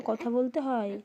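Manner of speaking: ordinary talk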